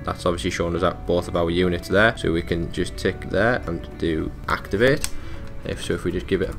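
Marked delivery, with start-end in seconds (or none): none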